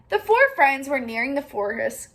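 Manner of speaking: usual speaking voice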